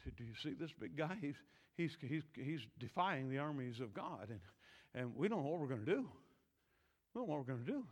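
low, soft, hurried confiding; hushed worry. Acted. Soldier telling David of the big guy, hushed worry. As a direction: stammer it quietly, at a loss.

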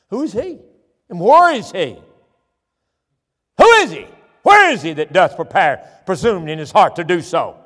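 outraged king shouting who did this; furious king. Acted. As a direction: roar the question at the queen. King demands the culprit.

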